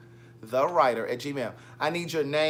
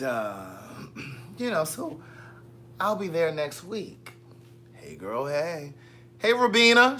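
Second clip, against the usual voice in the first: ordinary talk, nothing marked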